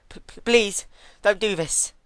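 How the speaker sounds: high, loud stuttering plea; urgent stammering plea. A narrator's reading. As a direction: stutter her name as you beg for mercy. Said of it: "she pleaded urgently".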